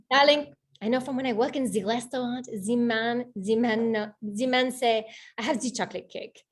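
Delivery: storytelling in a put-on French accent; best French accent. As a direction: play the Frenchman explaining, accent laid on thick. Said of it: "I'm gonna do my best French accent".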